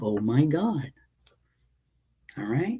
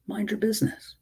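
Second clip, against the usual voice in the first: sharp high repeat; 10 semitones higher than usual. Real, not acted. Repeats the command to critics.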